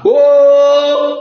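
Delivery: a sudden loud shout of "oh"; loud call. Real, not acted. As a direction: call out to the crowd, full voice. Calling out to Ivorians mid-rant. (appel crié fort)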